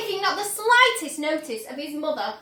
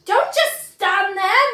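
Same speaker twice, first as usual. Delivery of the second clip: loud, slow, higher angry scream; screaming at husband; 5 semitones higher, 7 dB louder, slower than usual. Acted. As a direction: berate the husband. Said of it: "Missus Gloop screamed at".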